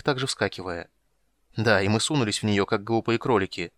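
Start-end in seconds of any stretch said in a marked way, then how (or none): none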